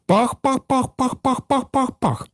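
racing engine puffs. A narrator's reading. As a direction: puff like an engine running flat out. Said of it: "дедалі швидше і швидше". (дуже високе швидке пахкання)

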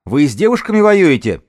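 high, loud challenge; shouted challenge. Acted. Woman leader challenges the hidden gang, loud high shout. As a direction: throw the question across the distance. Shouted exchange across a distance.

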